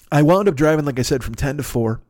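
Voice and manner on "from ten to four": usual speaking voice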